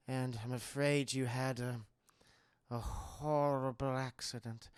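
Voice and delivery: low, soft, halting villain voice; sinister host. Retold or imitated. Howard breaking false bad news.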